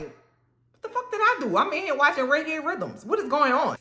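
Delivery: put-on high, startled kid voice; high alarmed. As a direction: squeak out your innocence. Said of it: "I'm like".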